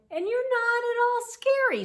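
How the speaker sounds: very high, loud owl remark; incredulous. A narrator's reading. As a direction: pipe it out high in disbelief. Owl challenging the monster.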